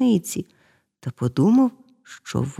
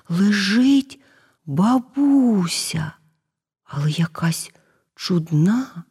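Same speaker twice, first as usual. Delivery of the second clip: plain reading voice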